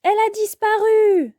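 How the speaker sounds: high-pitched cry of dismay. A narrator's reading. Sacha discovers the balloon is gone, dismayed cry. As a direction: cry out at a sudden loss. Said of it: "s'écrie Sacha".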